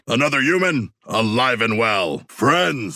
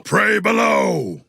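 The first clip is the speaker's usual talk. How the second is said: slow, high roaring battle cry; battle shout; 11 semitones higher, slower than usual. Acted. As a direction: bellow it at the enemy.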